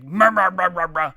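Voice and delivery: loud mocking blah-blah of a loudmouth; loud mock yammering; mock blustering. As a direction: bark the gibberish like a bully running his mouth. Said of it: "goateed looking jerk off is like".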